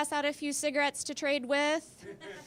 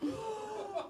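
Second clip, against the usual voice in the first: high gasp of horror; sharp shocked gasp; 8 semitones higher, 9 dB quieter than usual. Retold or imitated. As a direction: suck in air in shock. Dropped the chicken egg.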